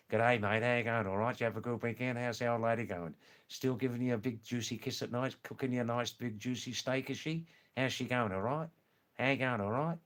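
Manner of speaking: fast, run-together Aussie small talk; broad Australian. Shown as a demonstration. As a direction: mumble through it like an Aussie mate, words merging. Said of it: "that sounds like this".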